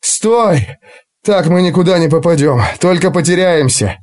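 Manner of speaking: high winded call to stop; breathless shout. Acted. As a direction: gasp it out after running. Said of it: "задыхаясь, прокричал он".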